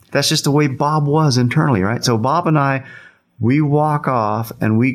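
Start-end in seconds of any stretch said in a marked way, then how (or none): none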